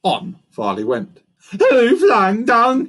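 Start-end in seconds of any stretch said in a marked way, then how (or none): 1.5-2.8 high, loud, slow monkey call; cry. A narrator's reading. Monkey still hunting the culprit, loud cry. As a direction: cry it out with the words stretched. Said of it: "he cried again and again".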